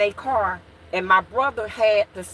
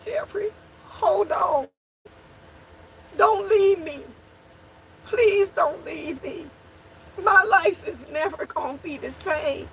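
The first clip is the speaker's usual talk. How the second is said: high, tearful begging through sobs; a sister crying over her dying brother; crying plea; 8 semitones higher than usual. Retold or imitated. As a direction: plead with him to stay alive. Holding her shot brother's head.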